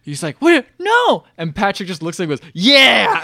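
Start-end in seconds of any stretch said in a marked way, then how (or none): 0.4-1.2 high, loud mock panic; alarmed protest. Boy trying to stop Patrick. 2.5-3.2 loud, high mock cheer; gleeful defiance. Patrick gleefully defying him.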